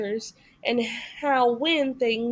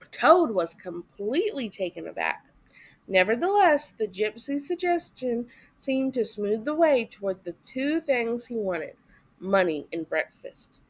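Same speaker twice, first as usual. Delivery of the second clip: usual speaking voice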